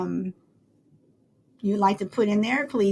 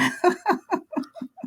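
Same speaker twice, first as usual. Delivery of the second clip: high-pitched burst of laughter; laughing; 10 semitones higher than usual. Real, not acted. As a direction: break into a high giggle. Laughs after reading chat answers.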